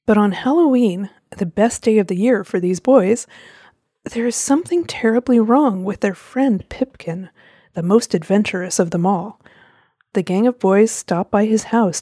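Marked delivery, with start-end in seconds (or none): none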